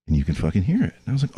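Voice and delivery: ordinary delivery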